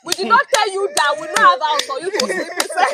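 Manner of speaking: loud, high mock outburst; family freaking out. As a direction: freak out like a scandalized relative. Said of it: "family will freak out".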